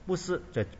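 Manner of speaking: ordinary talk, nothing marked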